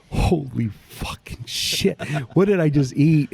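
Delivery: stunned exclamation. Retold or imitated. Retelling his first bite of brisket, stunned amazed outburst. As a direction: replay the shock of that first bite.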